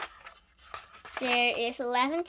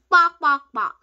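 loud, raised mocking clucks; chicken clucking; 3 semitones higher, 13 dB louder than usual. A narrator's reading. Bully circling the yard flapping arms, chicken clucking. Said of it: "Max clucked as he started".

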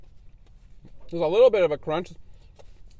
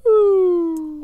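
impressed ooh; 12 semitones higher, 9 dB louder than usual. Real, not acted. Close-up look at the burger.

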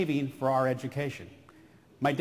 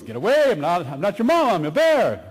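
loud, brusque cartoon bear; Yogi Bear; 6 dB louder than usual. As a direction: shoo the duck off, loud and emphatic. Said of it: "And Yogi, of course, says".

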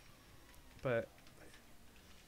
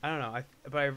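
normal talking voice

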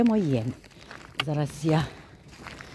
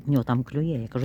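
ordinary talk, nothing marked

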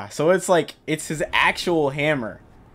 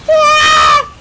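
very high loud shriek; real scream when hit; panicked scream; 12 dB louder than usual. Real, not acted.

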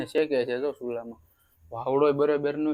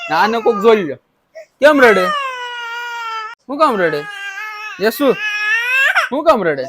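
calling out; 9 dB louder than usual. Real, not acted. Fussing over a crying child.

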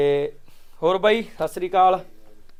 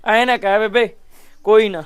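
loud, higher protest to stop tears; urgent consoling; 4 semitones higher, 12 dB louder than usual. Real, not acted. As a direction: cut in loudly to comfort someone crying. Urgently consoling a crying old woman.

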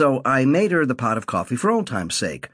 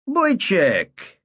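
put-on higher, fond old man; old man Saul; 9 semitones higher than usual. A narrator's reading. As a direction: say it like a doting grandpa. Saul addresses narrator.

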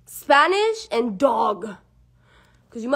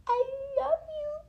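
put-on high baby-talk voice; 10 semitones higher than usual. Shown as a demonstration. Prompting Stitch to say it back, squeaky high coo. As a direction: coo it very high to get a bark. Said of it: "He's gonna do it, watch".

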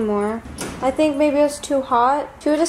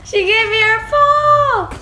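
excited; 11 semitones higher, 7 dB louder, slower than usual. Real, not acted. Thrilled at trick.

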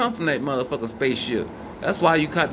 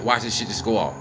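ordinary delivery

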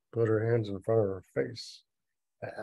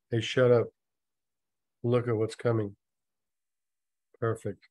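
deadpan re-reading; 3 dB louder than usual. Shown as a demonstration. Reader repeats the silly dialogue to listeners, deadpan with pauses. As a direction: pause after each short sentence. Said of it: "try not to laugh".

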